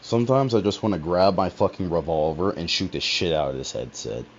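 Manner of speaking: yelling at the headset; angry. Real, not acted. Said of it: "rage coming out a bit".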